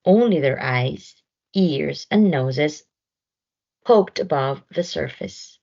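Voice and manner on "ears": usual speaking voice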